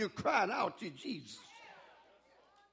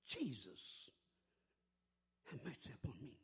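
low, much softer begging; hushed plea; 10 semitones lower, 18 dB quieter than usual. Retold or imitated. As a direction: plead it quietly, almost under breath. Blind man's plea, hushed.

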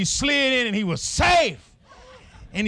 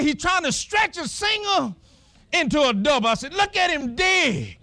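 high, thrilled narration; proud excitement; 7 semitones higher than usual. Retold or imitated. Father watching his son stretch a single, excited. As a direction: cheer your boy on.

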